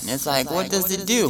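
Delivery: normal talking voice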